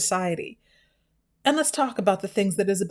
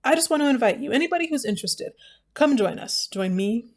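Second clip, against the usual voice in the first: normal talking voice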